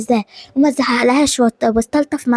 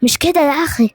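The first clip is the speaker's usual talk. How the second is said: normal talking voice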